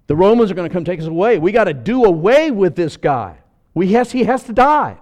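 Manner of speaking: loud, fast panicked plotting; panicked council. Acted. Council members plotting Jesus' death, loud and panicked. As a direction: whip up the fear of the council.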